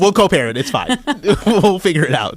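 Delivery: laughing through the words. Real, not acted. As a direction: chuckle the words out. Laughing while joking.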